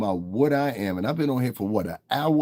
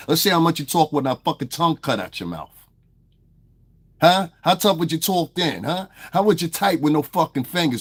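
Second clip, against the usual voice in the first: higher, heated threats; angry challenge; 4 semitones higher, 4 dB louder than usual. Real, not acted. Streamer daring a chatter to meet up, furious. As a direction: challenge him to a fight, voice raised.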